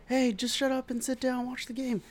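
high, mocking rival-fan voice; squeaky taunt of an annoying fan; high mock heckler; mock yelling fan. Fan yelling at him in stands.

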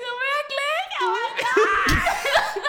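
high, loud, drawn-out disbelief; shocked. Retold or imitated. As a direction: squeal it in stunned disbelief. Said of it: "Und ich so". (hoch, laut und gedehnt ungläubig)

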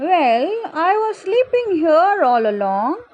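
a touch of yawn through the words; yawning pumpkin. A narrator's reading. Pumpkin explains it was sleeping here, mildly yawning. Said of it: "yawning again".

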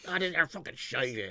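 lightly voiced complaining troll; mock grumpy troll. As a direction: mimic a moaning troll. Imitating a troll's WhatsApp message.

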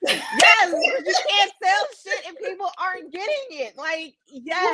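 high, emphatic amused outburst; laughing exclamation. Real, not acted. Teller reacting to owner's plea, laughing high exclamation. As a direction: burst in high-pitched, through a laugh.

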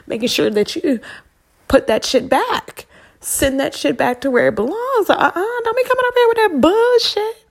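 high, loud, sassy swat-back; Serena mode sass. Pretend. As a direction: send it back with attitude, way up high. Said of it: "You gotta get your Serena on".

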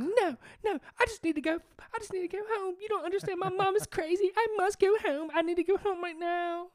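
put-on very high loud hysterical girl voice. Mocking the girl begging to be taken home, loud shriek. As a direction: shriek it like a panicking teen. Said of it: "she was like".

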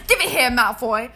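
loud, forceful put-on British; aggressive British accent. Host quoting Harry Potter to find the accent, aggressive British. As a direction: throw yourself into a pushy British accent. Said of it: "We were being really aggressive before".

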